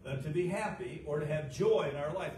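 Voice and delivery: usual speaking voice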